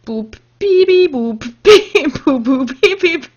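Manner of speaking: loud giggly beep-boop noises; toy robot voice. Pretend.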